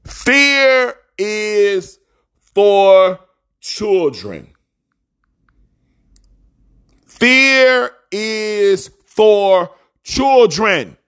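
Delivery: drawn-out, slow preacher's proclamation; slow booming sermon line; preaching refrain. Acted. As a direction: stretch every word like a pulpit refrain.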